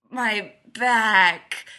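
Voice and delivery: loud, agonised groan on BACK; pained cry. A narrator's reading. Leonard crushed under a stranger, loud pained cry. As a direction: cry out in pain, hitting BACK hard and long.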